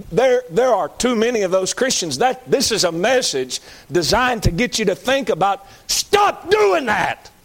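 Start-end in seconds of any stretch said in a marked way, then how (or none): 5.9-7.3 very high, slow shout; emphatic command. Real, not acted. Preacher ordering fake Christians to stop, slow high shout.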